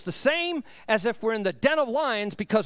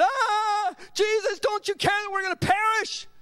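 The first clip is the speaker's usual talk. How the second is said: high, hammed-up panic; freaking out; 9 semitones higher than usual. Frightened disciples wake Jesus in the storm, mock freaking out. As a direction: cry out in mock alarm, pitched way up. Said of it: "the disciples are freaking out".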